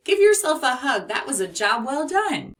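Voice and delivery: a teacher cheering the class after the lesson; cheerful praise. Acted. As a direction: lift the kids up with it. Cheering kids on.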